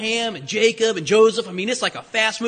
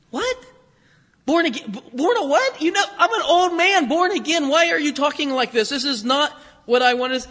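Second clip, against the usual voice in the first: higher-pitched mock disbelief; imitating an incredulous questioner, pitched up; baffled protest; 5 semitones higher than usual. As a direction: play a confused man objecting to nonsense. Acts out baffled, protesting Nicodemus.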